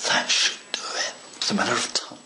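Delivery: whispered under the breath while working; hushed. Real, not acted. Modeller whispering to himself over delicate work. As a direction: breathe it out softly, focused on the cut.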